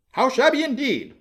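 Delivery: a squeaky chorus of yes-men; toadying councilors crying out. A narrator's reading. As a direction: echo the mayor like eager yes-men. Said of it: "cried the town councilors".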